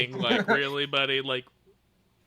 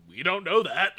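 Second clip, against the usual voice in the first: mock indignant; 5 semitones higher, 6 dB louder than usual. Arguing with Emily about death.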